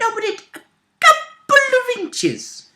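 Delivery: very high, slow, drawn-out squeal; playful squeaky-voiced understatement; comic squeak. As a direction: stretch it tiny and high. Squeaky drawn-out detail of tiny opening.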